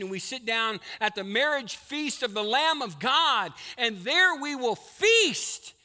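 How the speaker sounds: building from loud to a high, loud shout; preaching at full force. Real, not acted. Pastor proclaiming the coming feast to the church, building to a shout. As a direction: preach it, rising to a triumphant climax.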